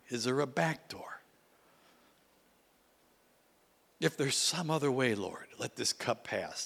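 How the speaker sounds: a plea that sinks into a whisper; pleading prayer. Retold or imitated. Praying to God for another way, fading to a whisper.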